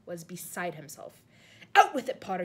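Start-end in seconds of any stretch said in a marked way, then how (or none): 1.8-2.4 loud, raised bellow at Potter; bellowed furiously. A narrator's reading. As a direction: roar the demand. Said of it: "he bellowed".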